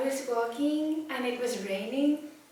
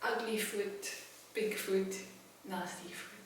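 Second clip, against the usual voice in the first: much softer, grossed-out murmur; hushed disgust; 13 dB quieter than usual. Real, not acted. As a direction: whisper the horror of the foot. Disgusted quiet description.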